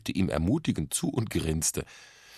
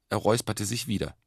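usual speaking voice

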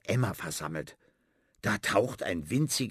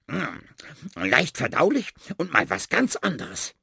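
smacking lips; 5 semitones higher, 3 dB louder than usual. Acted. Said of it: "Die Ratte schmatzt".

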